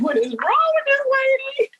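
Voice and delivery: high mock disbelief at the sobbing lady; baffled husband. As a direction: play the baffled husband staring at his crying wife. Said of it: "Mark was looking at me like".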